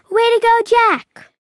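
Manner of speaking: high, loud happy cheer; bright shout of congratulations. A narrator's reading. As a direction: cheer him across the finish line. Friend cheering at finish line.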